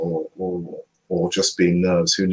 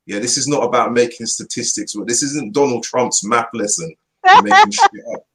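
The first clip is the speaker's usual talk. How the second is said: ordinary talk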